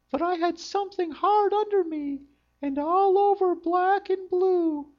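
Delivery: very high, loud cartoon princess; a squeaky, complaining princess. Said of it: "said the princess".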